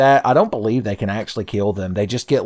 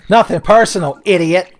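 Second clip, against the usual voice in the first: higher, louder smug edgelord taunt; loud cocky mock edgelord one-liner; edgy taunt; 8 semitones higher, 8 dB louder than usual. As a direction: sneer it like a cool anime rival. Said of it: "Like this fucking thing".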